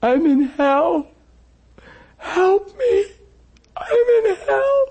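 high, slow wailing man in despair; crying man. Retold or imitated. Husband on the phone, wailing high and slow. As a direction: wail it like a broken man sobbing.

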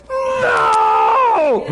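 high, drawn-out cry of no; hammed-up high wail of protest; frantic inner protest. Preacher's mind protesting during the phone call, frantic protest. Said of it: "And I'm going".

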